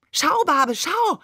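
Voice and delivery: high, eager little voice; proud child calling. A narrator's reading. Seestern proudly presents his date man, proud child calling. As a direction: call for father's attention, pitch jumping up. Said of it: "präsentierte stolz sein Dattelmännchen".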